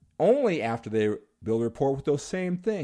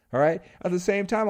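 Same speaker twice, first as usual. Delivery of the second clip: ordinary talk, nothing marked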